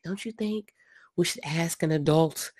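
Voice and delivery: hushed, whispered aside; playful stage whisper; mock schoolkid whisper. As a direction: whisper it like a cautious kid at school. Imitating timid cautious schoolkid, unvoiced.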